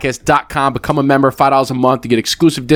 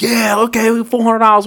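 high-pitched mock celebration; retold cheering; 11 semitones higher than usual. Two young gamblers celebrate winning their bet, cheering. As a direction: cheer like you just won, voice pitched up. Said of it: "My buddy and I are cheering".

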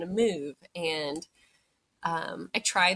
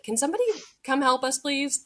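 high, fast, raised voice; retold panicky plea, pitched up; urgent call for help; 9 semitones higher, 4 dB louder than usual. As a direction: imitate calling urgently down a hallway. Husband calling down hallway for help.

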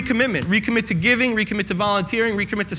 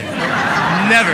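laughing at own joke; 5 dB louder than usual. Real, not acted.

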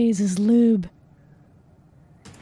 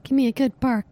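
ordinary delivery

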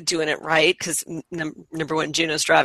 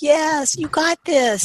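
high-pitched coaxing coo; cooing encouragement; 9 semitones higher, slower than usual. Retold or imitated. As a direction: coo it along like coaxing a finicky pet. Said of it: "It just needs to be cooed at".

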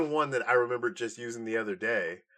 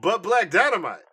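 proud declaration, raised and loud; movie quote voice; 9 semitones higher, 8 dB louder than usual. Retold or imitated. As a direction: belt it like a blaxploitation hero.

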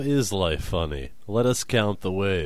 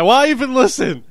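exasperated squeal; 14 semitones higher, 10 dB louder than usual. Real, not acted. Exasperated outburst.

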